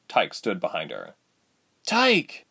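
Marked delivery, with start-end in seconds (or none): 1.8-2.4 high, hopeful call of his name; glimmer of hope. A narrator's reading. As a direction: call to him with sudden hope, pitched way up. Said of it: "with a glimmer of hope".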